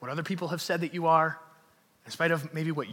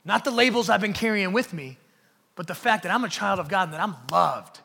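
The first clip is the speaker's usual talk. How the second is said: loud preacher voicing a defiant believer; defiant declaration; 3 semitones higher, 8 dB louder than usual. Congregant walking out rejecting old labels, defiant declaration. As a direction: build from firm refusal to full-force, raised proclamation. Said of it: "walk out of this room saying".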